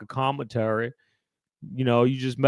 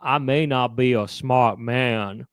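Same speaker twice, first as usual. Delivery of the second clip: slow, drawn-out Forrest Gump; slower than usual. Retold or imitated. Friend shows how Forrest Gump really talks, slow. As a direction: imitate Forrest Gump's slow earnest drawl. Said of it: "Forrest Gump would say".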